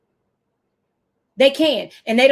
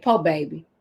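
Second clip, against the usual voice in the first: mock pity; 8 semitones lower, 5 dB quieter than usual. Teacher voicing her words to kids.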